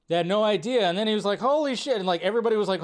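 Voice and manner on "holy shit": high, awed exclamation; retold amazement. Party host learning who is back, high amazed exclamation. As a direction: imitate someone suddenly floored.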